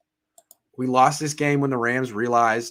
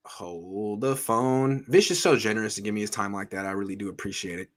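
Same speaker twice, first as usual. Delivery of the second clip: normal talking voice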